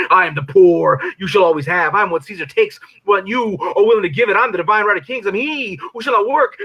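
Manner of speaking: ranting devil crescendo; devil persona rising. Acted. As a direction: preach it like the devil on a pulpit. Persona poem builds to climax.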